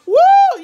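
loud, high cackling laugh with a whoop; over-the-top laugh. Shown as a demonstration. Showing how she didn't laugh, loud high whooping cackle. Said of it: "It wasn't like I was all".

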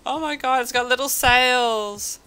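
loud, high, drawn-out amazed delight; delighted outburst. Real, not acted. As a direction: cry it out, about to burst into laughter. Amused discovery, bursts out laughing after.

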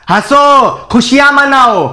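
high, drawn-out calls with falling ends; sarcastic exhortation. Acted. Sarcastically orders crowd to celebrate. (कड़वे तंज़ में पुकार)

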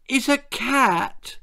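high, slow tearful whimper. Acted. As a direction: plead through tears. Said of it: "whimpered Angela".

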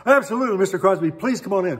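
loud, high, flustered eagerness; gushing welcome. Retold or imitated. Starstruck lady inviting [name] in, gushing welcome. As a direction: fall over yourself to welcome the star.

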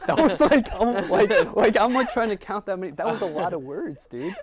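high, loud, laughing protest; laughing tease. Real, not acted. Host teases friend about picking many words, laughing. Teasing over too long an answer.

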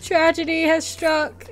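hammed-up doom; mock dramatic. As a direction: play it as mock tragedy. Mock dramatic game announcement.

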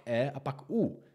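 the sounds "E" and "U" said slowly, one by one; sound demonstration. Shown as a demonstration. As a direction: demonstrate "E", then "U", slowly and separately. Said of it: "jednou se to vysloví". (pomalá ukázka hlásek „E“ a „U“)

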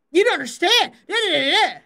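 hounding whine. Retold or imitated. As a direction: keep pushing him to listen. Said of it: "I would just hound him".

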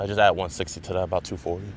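ordinary delivery

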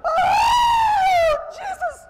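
very high, very loud mock scream; hammed-up shriek of terror. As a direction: Eve should have screamed at the snake, a mock scream. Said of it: "She should have screamed and said".

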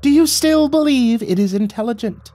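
high, loud imperious question; high-pitched royal indignation; demanding sprite king. A narrator's reading. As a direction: demand it as a tiny, frustrated king. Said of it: "he demanded".

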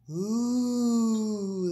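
drawn-out wondering ooh; amazed gasp. Acted. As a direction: gasp in awe. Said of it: "the crowd gasped".

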